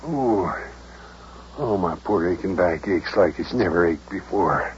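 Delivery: 低沉地装模作样呻吟; 夸张地装出腰疼的哀叹; 假装背痛. Pretend. Mayor fakes back pain.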